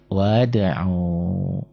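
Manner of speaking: wada'u very slowly, skipping the silent letter; wada'u with a long drawn-out final u; connected reading demo. Shown as a demonstration. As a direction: model wada'u slowly, vowels stretched. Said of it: "nggak dibaca".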